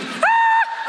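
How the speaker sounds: long, very high "aah"; acted falling scream; terrified scream. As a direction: scream as you plummet. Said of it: "screaming at the top of my lungs".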